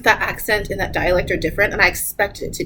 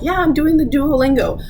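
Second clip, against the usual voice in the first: lightly imitated learner, higher pitch; mock eager learner; 7 semitones higher, 3 dB louder than usual. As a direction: mimic someone proudly reporting in, pitched up. Mimicking naive Duolingo learners.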